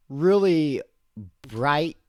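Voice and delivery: ordinary delivery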